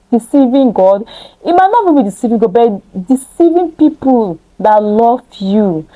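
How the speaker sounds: ordinary talk, nothing marked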